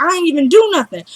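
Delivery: high, quick retold protest; protesting innocence. Driver tells police he did nothing, high indignant protest. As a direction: plead your case high and fast.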